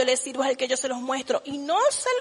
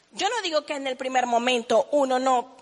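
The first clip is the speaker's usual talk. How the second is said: ordinary talk, nothing marked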